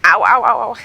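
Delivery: loud hammed-up cries of pain. [name] after falling on her head, loud mock ow. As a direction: yelp it like a bumped head. Injured rider after a fall.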